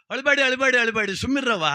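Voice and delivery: acted high-pitched plea to stop crying; urgent hushing. Acted man hushing wailing women. (ಅಳು ನಿಲ್ಲಿಸುವ ನಟನೆಯ ಏರುದನಿ)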